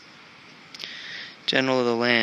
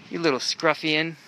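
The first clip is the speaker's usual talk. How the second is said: cooing at cats; 3 semitones higher, 4 dB quieter than usual. Real, not acted.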